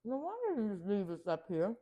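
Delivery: talking while chewing; words round a mouthful of food; mouth full. Real, not acted. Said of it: "talking with food in my mouth".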